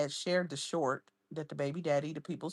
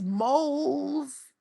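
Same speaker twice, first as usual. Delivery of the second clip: ordinary talk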